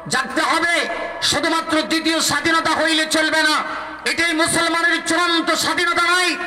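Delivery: high sustained rallying cry, climbing to a peak; impassioned preaching. Real, not acted. Preacher rousing the Sylhet crowd for Islam, high impassioned preaching. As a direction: preach at full force, pitched high and held, building to the final call.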